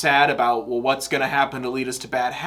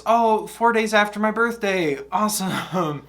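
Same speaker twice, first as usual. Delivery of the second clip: higher, mock-cheery sarcasm; a dry joke about the date; sarcastic; 9 semitones higher, 4 dB louder than usual. Real, not acted. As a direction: fake delight at bad news. Sarcastic reaction to release date.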